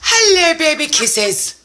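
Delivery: high cooing hello that falls away. Real, not acted. Greeting friend's dog on camera, cooing. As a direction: coo to a little dog.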